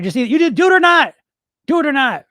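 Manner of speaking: insistent do-it-or-not call; emphatic push. Real, not acted. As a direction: hammer the point at the dieters. Pressing dieters to commit.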